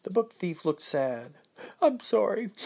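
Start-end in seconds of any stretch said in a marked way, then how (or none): 1.8-2.5 higher, sniffly apology; sniffled. Acted. Book thief apologising to the children, higher sniffly voice. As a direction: thief sniffling out a sorry in a raised little voice. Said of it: "he sniffled".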